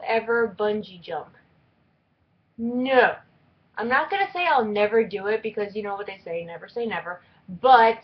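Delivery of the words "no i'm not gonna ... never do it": normal talking voice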